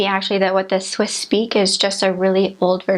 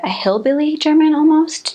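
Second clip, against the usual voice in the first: put-on a quick hillbilly German accent, higher and louder; 7 semitones higher, 3 dB louder than usual. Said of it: "it sounds a little bit like".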